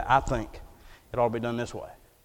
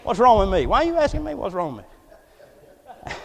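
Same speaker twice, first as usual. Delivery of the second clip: sarcastic comeback; 9 semitones higher, 6 dB louder than usual. Retold or imitated. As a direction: play the baffled patient talking back. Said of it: "I said".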